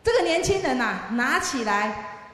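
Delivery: ordinary talk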